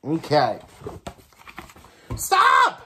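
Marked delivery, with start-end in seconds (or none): 2.2-2.8 high shriek of alarm. A narrator's reading. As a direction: shriek it out to freeze the line. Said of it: "shrieked the littlest ant".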